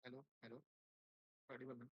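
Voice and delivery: faint, far from the mic; too quiet to hear well; barely audible. Real, not acted. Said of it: "Your voice is very low".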